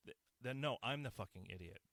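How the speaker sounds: normal talking voice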